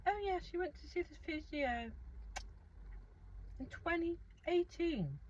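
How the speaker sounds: lightly voiced high prissy nurse; mocking the nurse. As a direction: send up her know-it-all tone. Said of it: "the nurse was like".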